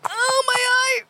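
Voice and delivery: very high, strained squeal; straining poop voice. Pretend. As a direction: squeeze it out in a tiny high voice. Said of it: "Your poop voice".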